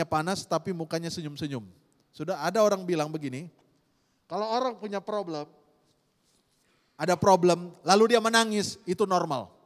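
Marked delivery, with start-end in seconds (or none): none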